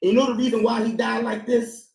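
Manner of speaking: ordinary delivery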